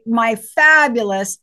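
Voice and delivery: ordinary delivery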